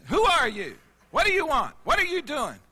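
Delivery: loud, high, demanding questions. Retold or imitated. Angry homeowner confronts the salesman at his door. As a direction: fire the questions at him.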